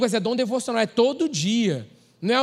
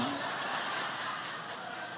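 breathy laugh; 5 semitones higher, 13 dB quieter than usual. Real, not acted. Joking about international baptism.

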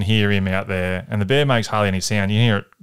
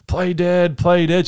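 retold scream; 9 semitones higher, 3 dB louder, slower than usual. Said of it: "She's screaming".

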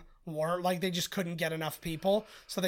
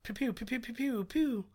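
pew pew; 5 semitones higher, 4 dB quieter than usual. Retold or imitated. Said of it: "That's what my son would be doing".

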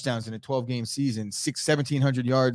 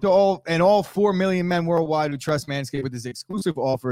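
sponsor ad read; 3 semitones higher than usual. Acted. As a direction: sell the offer hard. Cohost continues sponsor read.

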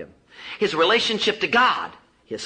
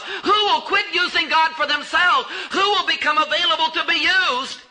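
fiery altar-call preaching; full-force preaching; 7 semitones higher than usual. Real, not acted. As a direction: call the congregation out, one after another.

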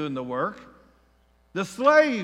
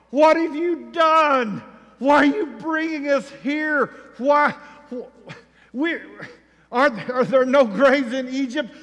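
higher, loud, indignant outcry; accusing shout; 6 semitones higher, 5 dB louder than usual. Retold or imitated. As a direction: hurl the blame at Moses. Israelites accusing Moses.

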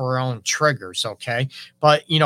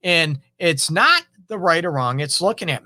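ordinary delivery

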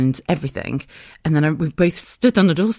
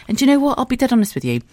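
ordinary talk

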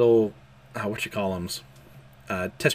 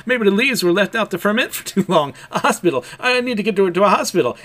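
frantic; 8 semitones higher, 6 dB louder than usual. Retold or imitated. Yor frantic over sick child.

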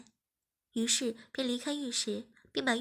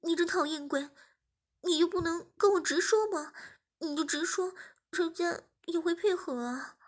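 higher, louder playful scolding; 5 semitones higher, 4 dB louder than usual. Acted. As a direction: scold him fondly. (娇嗔责怪)

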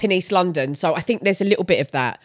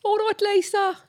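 high, softer Brummy greeting; Brummy accent; 11 semitones higher, 6 dB quieter than usual. Shown as a demonstration. Demonstrating accent number seven, high Brummy accent. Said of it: "um, Brummy".

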